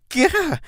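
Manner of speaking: sharp high startled yelp; startled gah. Acted. Mayor caught on the bed, startled yelp. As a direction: jump out of your skin.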